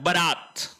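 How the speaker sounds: बारात with short first vowel, "ba-raat"; correct pronunciation. Shown as a demonstration. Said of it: "जबकि बारात होता है". (बारात का पहला स्वर छोटा "ब")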